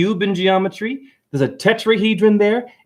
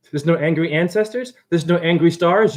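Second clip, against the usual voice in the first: normal talking voice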